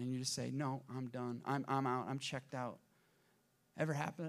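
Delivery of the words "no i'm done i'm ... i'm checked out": low, soft acted resignation; understated end-of-rope weariness; worn-out surrender. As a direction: give up quietly, pitched down. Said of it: "your body just completely breaks down".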